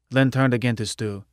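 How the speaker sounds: ordinary delivery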